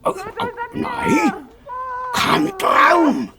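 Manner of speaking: panicked wail. Acted. Wolf wakes to find it wasn't a dream, wailing in panic. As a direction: yelp in horror as it sinks in.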